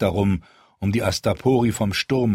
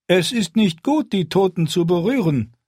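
a much higher, louder warning; lightly voiced warrior; 11 semitones higher, 4 dB louder than usual. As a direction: warn the queen off the corpses. Warrior warns about the dead.

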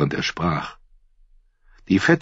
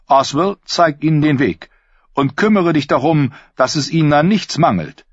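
a higher, gracious lord's voice; smooth courteous host; 7 semitones higher than usual. Acted. Lord welcomes the tired knights, higher and gracious. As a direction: play the silky host welcoming guests.

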